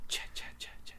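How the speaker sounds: eerie breathy whisper of kill and ch-. Shown as a demonstration. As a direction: whisper it like the killer's echo. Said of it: "Jason Voorhees is supposed to be".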